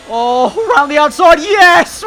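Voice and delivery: high, loud play-by-play call; esports caster. Acted. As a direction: shout the overtake like a caster. Calls the overtake like caster.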